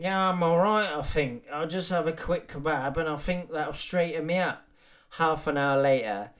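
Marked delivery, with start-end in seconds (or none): 0.0-4.7 higher woozy reassurance; lightly voiced drunk convincing himself; drunk self-talk. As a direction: talk yourself up after the club. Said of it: "you think".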